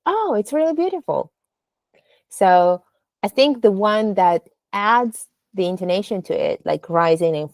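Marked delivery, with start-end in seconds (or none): 0.1-1.3 "Oh" swept up high with delight. Shown as a demonstration. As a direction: gush about the lovely day. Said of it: "then you will be like".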